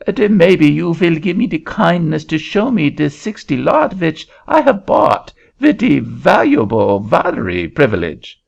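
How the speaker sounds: put-on high, lilting French-accented Frenchman calling out; a Frenchman hailing a farmer in a French accent. Frenchman calling to a farmer, high French accent. Frenchman asks about his lot.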